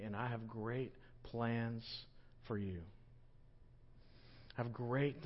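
Jesus tenderly assuring Peter; tender reassurance. Acted. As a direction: gently reassure him of his worth.

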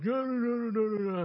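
high whining no, no, no from the son; protesting kid. Retold or imitated. Son refusing his dad, a high protesting whine.

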